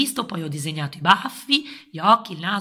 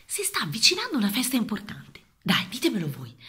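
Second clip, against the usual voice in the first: hushed, secretive opening; whispering. Acted. Children's host whispers intro.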